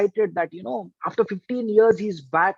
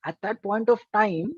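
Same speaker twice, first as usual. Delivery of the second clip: ordinary talk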